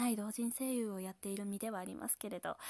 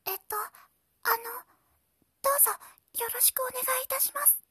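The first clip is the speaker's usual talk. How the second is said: put-on much higher, loud little girl voice; squeaky child greeting, pitched way up; 7 dB louder than usual. Shown as a demonstration. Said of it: "幼い女の子もまた訓練中でして". (幼い女の子の声)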